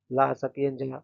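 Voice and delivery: normal talking voice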